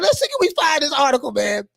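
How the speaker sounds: ordinary delivery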